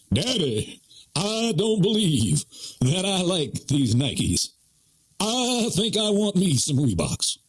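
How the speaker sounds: mock preacher oratory from a little kid; slow, high, long-drawn preacher cadence; Martin Luther King. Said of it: "Martin Luther King talked like that".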